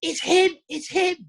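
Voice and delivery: high-pitched mock scream of recognition; high, excited fan's cry, not full volume; retold scream. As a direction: imitate an excited girl squealing to her friend. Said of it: "I heard her screaming to her friend".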